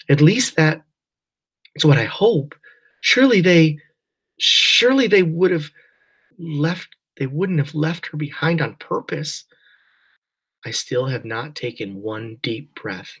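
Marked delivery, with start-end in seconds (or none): 0.1-9.5 higher, tense, pleading; cling to hope, voice tight; anxious hope. A narrator's reading. Mother anxiously hopes daughter not abandoned.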